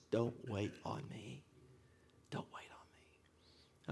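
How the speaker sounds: slow, fading into a bare whisper; whispered release. Retold or imitated. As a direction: let it sink to a slow whisper. Releasing his dying dad.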